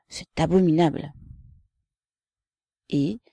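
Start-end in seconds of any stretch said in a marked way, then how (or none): none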